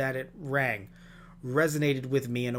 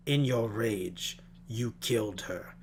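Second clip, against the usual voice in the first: slow, grave film-villain delivery; measured, ominous verdict, trailing lower; villain impression; 3 semitones lower, 3 dB quieter, slower than usual. Host quoting the film's villain, slow grave mock pronouncement.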